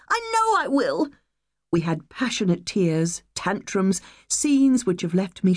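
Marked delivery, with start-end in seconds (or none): 0.1-1.1 upset daughter protesting the move, high and tearful; crying child. Acted. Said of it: "she cried".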